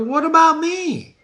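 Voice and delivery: high, pleading What about me; like a customer at a fortune teller; eager Banquo. Retold or imitated. Teacher imitating Banquo at a fortune teller, high and pleading. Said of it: "he says".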